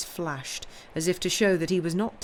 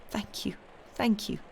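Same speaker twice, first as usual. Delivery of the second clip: high, whispery murmured thanks; grateful murmur; 4 semitones higher, 4 dB quieter than usual. A narrator's reading. Granddaughter thanking her grandfather, murmuring softly. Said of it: "she murmured".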